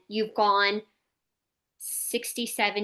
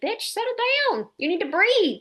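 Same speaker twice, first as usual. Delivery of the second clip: sassy mock daughter; 11 semitones higher, 4 dB louder than usual. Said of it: "be like".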